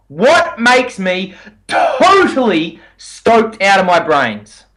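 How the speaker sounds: "totally" stretched long and punched; slow delivery, dwell heavily on "totally"; stress on totally. Shown as a demonstration. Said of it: "I emphasize the word totally".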